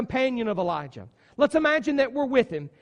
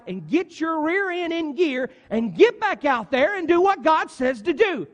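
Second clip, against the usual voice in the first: a friend hollering at a moping prophet; tough-love yell; 5 semitones higher than usual. Acted. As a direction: bark at him to snap out of it. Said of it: "And then we would say".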